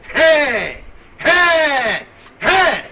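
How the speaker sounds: very loud, high angry cries; repeated full-force warning yells; shouting. Acted. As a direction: bellow each one as a separate blast. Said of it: "Why are you shouting?".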